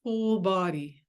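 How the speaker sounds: guided meditation. Acted. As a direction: ease them gently into stillness.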